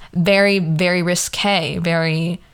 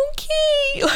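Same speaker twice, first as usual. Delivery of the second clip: teary squeak. Retold or imitated. Crying, accepting his answer.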